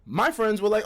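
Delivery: ordinary delivery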